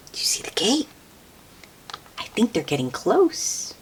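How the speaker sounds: whispered question with a lift at the end. Real, not acted. Hushed question to child listener.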